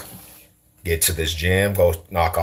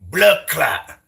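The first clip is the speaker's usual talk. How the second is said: high, punched Jamaican exclamations; mock a Jamaican homie in bursts; Jamaican impression; 8 semitones higher, slower than usual. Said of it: "The homie in Jamaica".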